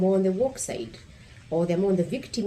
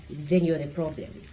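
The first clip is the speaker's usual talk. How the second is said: usual speaking voice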